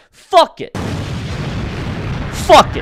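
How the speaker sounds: high pitched loud defiance; defiant shout. Acted. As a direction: shrug it off loudly. Defiant outburst again.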